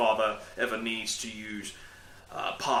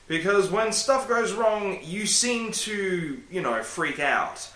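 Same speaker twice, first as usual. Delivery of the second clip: higher, very slow patient lecture; slow self-scolding; 7 semitones higher, slower than usual. Pretend. Vlogger lecturing himself about panicking, slow and patient. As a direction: drag it out like lecturing a child. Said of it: "Why am I talking like that?".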